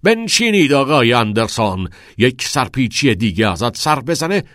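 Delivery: loud, commanding warning; angry shout. A narrator's reading. Nolan forces Todd back into his seat, angry shout. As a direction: threaten the pupil at full voice. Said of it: "فریاد زد".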